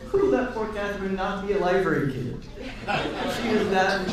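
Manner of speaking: ordinary talk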